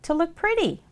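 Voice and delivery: high, prim soap voice; pretty soap voice. Acted. Pretty soap tells ugly soap its purpose, high and prim. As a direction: answer up high, pleased with yourself.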